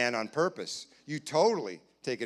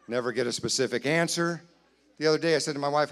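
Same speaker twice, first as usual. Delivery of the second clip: ordinary talk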